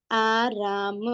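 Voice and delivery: long aa vowels in आराम; syllable-by-syllable reading. Shown as a demonstration. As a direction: draw out आराम, ending on a separate ma. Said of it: "ध्यान दे और आवाज देखे".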